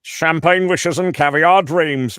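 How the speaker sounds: high, slow, grandly stretched showman voice; drawn-out posh TV host boast; Robin Leach impression. Voice actor doing Robin Leach for the editor, high and slow. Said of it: "this guy's editing, Lifestyles".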